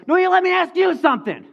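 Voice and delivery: high, loud shouted comeback; hammed-up furious retort; mad. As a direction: fire the demand back angrily. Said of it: "that got me mad".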